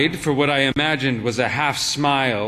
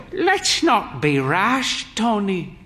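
put-on higher, slow, gently chiding old woman; slow higher voice of an older wife; Mother's voice; 7 semitones higher, slower than usual. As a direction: rein him in with a drawn-out, higher voice.

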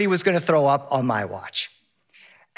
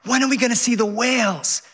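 higher, a light yell over the roar of the wind; kid yelling over wind; 6 semitones higher than usual. Retold or imitated. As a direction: call out like an excited boy. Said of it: "Henry yells".

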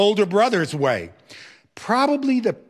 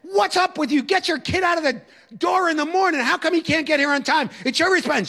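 high, raised scolding voice; retold yelling; 12 semitones higher than usual. Teacher scolding the mother over lateness, raised voice. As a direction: tough guy teacher telling off the mother. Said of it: "I'm yelling at her".

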